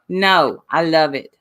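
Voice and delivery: normal talking voice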